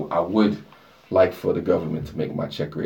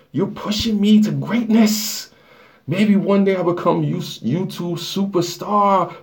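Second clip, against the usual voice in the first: squeaky, much higher, drawn-out hype voice; high put-on voice; 11 semitones higher, 5 dB louder, slower than usual. Thanking fourteen livestream viewers in a high squeaky put-on voice. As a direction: gush to the viewers like a cartoon superstar.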